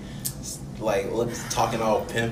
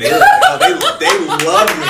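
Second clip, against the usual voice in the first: higher, louder, laughing hard; 8 dB louder than usual. Real, not acted. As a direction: burst out laughing. Laughing about old ladies.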